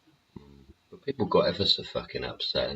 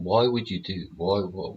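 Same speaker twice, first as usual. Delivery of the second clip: ordinary talk, nothing marked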